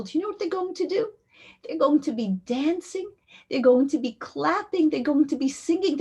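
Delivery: put-on high-pitched, building storytelling to a child; fervent promise. Woman tells grieving girl of angels above, fervent and high. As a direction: paint the wonder with rising fervor.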